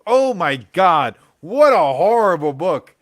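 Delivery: loud, slow disgusted rant. Real, not acted. Trashing a bad comic to his chat, loud and disgusted. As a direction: let the disgust pour out. Ranting about horrible book.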